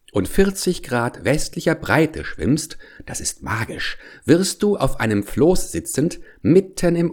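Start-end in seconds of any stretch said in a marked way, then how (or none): 3.1-4.0 soft secret whisper; whispered aside. Acted. Aside to the listening child.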